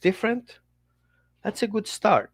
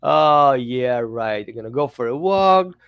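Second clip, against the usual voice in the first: sarcastic dog; 5 semitones lower, 5 dB louder than usual. Retold or imitated. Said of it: "he's like".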